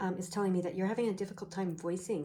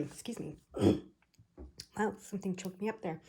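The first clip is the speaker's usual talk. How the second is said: ordinary delivery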